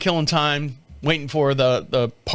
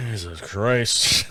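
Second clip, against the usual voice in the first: weary groan; 7 semitones lower, much slower than usual. Retold or imitated. Despair at playlist.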